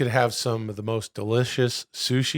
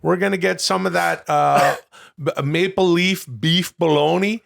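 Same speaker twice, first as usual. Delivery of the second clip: higher, louder hammed-up Canadian accent with eh; mock Canadian; 6 semitones higher, 5 dB louder than usual. Host joining the Canadian bit for his co-host, hammed-up Canadian accent. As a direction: play a loud Canadian bro at the gas station.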